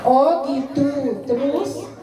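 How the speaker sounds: slow, calm "Oh, gitu" with a rise; calm listening. Shown as a demonstration. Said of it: "Itu namanya mendengar aktif". (ibu mendengarkan anak dengan tenang dan pelan)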